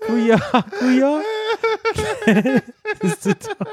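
high, squeaky laughing fit; helpless laughter. Real, not acted. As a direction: barely get the words out for laughing. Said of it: "ich muss grad echt heulen".

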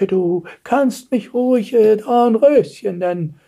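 put-on slow, high old-lady voice; ancient Dornröschen. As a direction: speak haltingly as a doddery old beauty. Ancient Dornröschen introduces herself. (uralte Dame, langsam und hoch)

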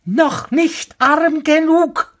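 very high, slow bird voice; taunting sparrow. A narrator's reading. (hoch und gedehnt höhnender Spatz)

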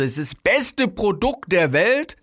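high, stretched, exaggerated hype; effusive overselling. Seller praising the product, over-the-top effusive. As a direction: gush over the product in a put-on voice. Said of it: "so überschwänglich übertreibe".

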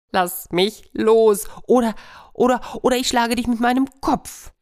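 high, loud, stretched-out hare's cry; desperate hare. Acted. Stuck hare panics.

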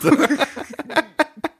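high, helpless laughter. Real, not acted. Storyteller cracking up at imagined car scene, high laughter.

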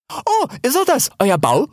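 high, excited question; amazed. Acted. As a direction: marvel at it in a high voice. Hel sees the den, amazed. (staunend, mit hoher Stimme)

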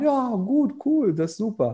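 playing along with a strange compliment, mock cheerful; mock enthusiasm. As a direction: humor her with overdone politeness. Mock-polite reply to numerology.

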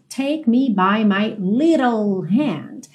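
slow model line, tapping the middle of "little"; American tapped T. Shown as a demonstration. Teacher modelling American "little", slowly, with tapped T. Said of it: "Esse little aí ganhou rarará".

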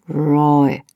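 "Roy" langsam und deutlich gedehnt; gälisch ausgesprochen. Shown as a demonstration. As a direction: das gälische "Roy" ganz langsam vorsprechen. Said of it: "das Wort mehrmals aussprechen". ("Roy" bedächtig gälisch ausgesprochen)

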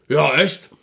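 a hint of a gloating neighbour; mocking imitation. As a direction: taunt them like a show-off with his pork schnitzel. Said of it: "Die, die dir einen vormachen".

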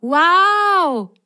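high, loud wow of delight; excited cry. Acted. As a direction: burst out in amazement. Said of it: "rief Grasgrün".

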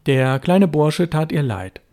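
ordinary delivery, nothing marked

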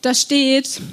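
usual speaking voice

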